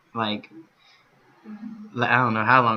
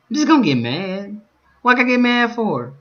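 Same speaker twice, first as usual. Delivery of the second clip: higher, rising frustration; frustrated; 8 semitones higher than usual. Retold or imitated. Vlogger venting his inner thoughts, frustrated. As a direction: climb in pitch, fed up. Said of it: "in my head".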